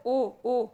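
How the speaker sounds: ओ, ओ said loud as an open rounded o; rounded o demo. Shown as a demonstration. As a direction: stretch the ओ sound clearly. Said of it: "ओ को आगत की श्रेणी में रखा गया है".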